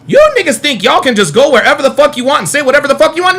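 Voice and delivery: ordinary talk, nothing marked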